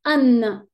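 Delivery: an said loud and held as a model; nasal an sound. Shown as a demonstration. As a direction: say an clearly, like a teacher's model. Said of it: "On va dire".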